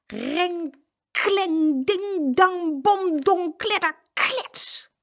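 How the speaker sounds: high, ringing clatter noises; crashing cans. A narrator's reading. Narrator imitates cans falling on Jeroen, high noisy crashing. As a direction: act out a tower of tins tumbling.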